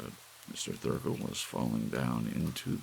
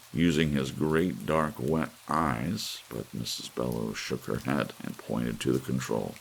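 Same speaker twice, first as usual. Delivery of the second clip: usual speaking voice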